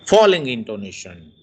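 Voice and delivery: ordinary delivery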